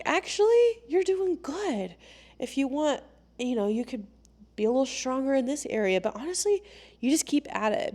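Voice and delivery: high, reassuring coach voice; encouraging coach. Acted. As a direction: reassure them in a lifted, lighter voice. Acts out a supportive coach's reply.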